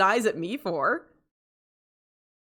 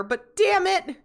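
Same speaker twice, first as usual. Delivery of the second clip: whiny outburst; 11 semitones higher than usual. Real, not acted. Whiny outburst about cold toilet.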